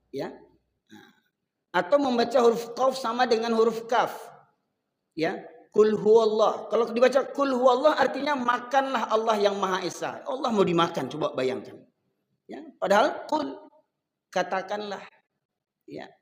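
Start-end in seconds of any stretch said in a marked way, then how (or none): none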